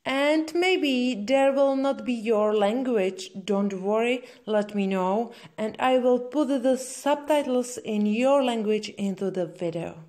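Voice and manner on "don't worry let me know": ordinary talk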